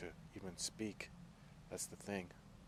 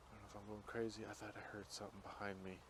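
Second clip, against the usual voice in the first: hushed unease; 14 dB quieter, faster than usual. Real, not acted. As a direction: keep it down, listening behind you. Uneasy, heard something in dark woods.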